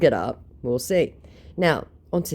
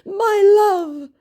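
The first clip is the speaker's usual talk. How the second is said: high, loud mock lament; wounded scammer; 8 dB louder, much slower than usual. Scammer questions her rejection, mock-wounded high wail. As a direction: stretch it into a hammed-up lovelorn wail. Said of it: "he goes".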